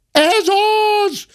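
very high accusing cry; maid's cry. A narrator's reading. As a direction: cry out as you spot the culprit. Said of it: "kiáltotta a szobalány".

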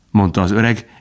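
plain reading voice

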